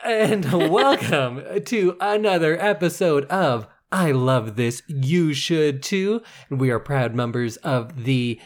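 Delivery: drawn-out, measured announcer delivery; podcast host intro. Acted. Host welcoming listeners to another episode, announcer style. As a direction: present the show, stretching names out slowly.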